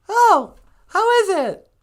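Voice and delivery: very high, loud, slow question; sweetie character. Acted. Sweetie asking the traveller about the place, high put-on voice. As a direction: call out curiously in a squeaky voice.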